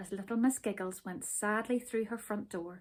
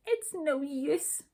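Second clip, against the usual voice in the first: slow, hopeless sigh; 6 semitones higher, 4 dB louder, slower than usual. A narrator's reading. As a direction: let it out slowly and higher. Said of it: "she sighed".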